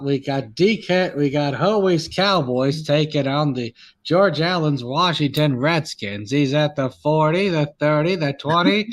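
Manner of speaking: sportscaster calling a run in a measured, punched cadence; old-time play-by-play voice, hitting each phrase; Howard Cosell. Acted. Mock broadcaster calling the game for friends, Howard Cosell impression.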